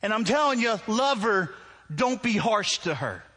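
high, loud stern warning; God. Acted. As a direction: lay down the law as an emphatic voice from above. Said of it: "it's like God saying".

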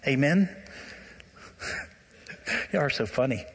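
ordinary talk, nothing marked

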